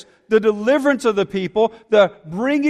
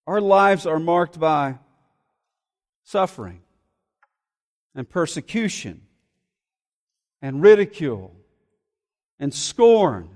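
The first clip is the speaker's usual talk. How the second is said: lament reading. Acted. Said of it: "And it says".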